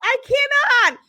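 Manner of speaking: high, incredulous exclamation; can't believe him, voice shooting up; exasperated disbelief. Real, not acted. Telling viewers she can't believe his response.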